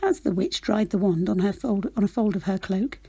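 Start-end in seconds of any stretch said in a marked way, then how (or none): none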